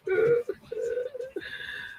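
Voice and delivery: helpless high-pitched laughing fit; wheezy cackle; high wheezing laugh. Real, not acted. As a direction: crack up with a squeal. Breaks up laughing.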